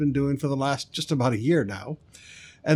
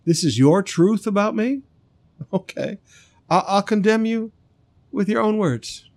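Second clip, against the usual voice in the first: higher, mocking disbelief; sarcastic; 6 semitones higher, 3 dB louder than usual. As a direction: needle him with mock surprise. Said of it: "saying this facetiously".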